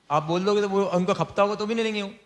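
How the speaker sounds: ordinary delivery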